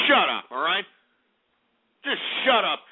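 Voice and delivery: ordinary talk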